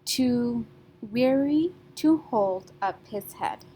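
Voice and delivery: slow line, beats on weary, hold, head; stressed beats. Shown as a demonstration. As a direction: draw it out, stressing the first syllable of weary, then hold and head. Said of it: "Can you see the three stressed syllables".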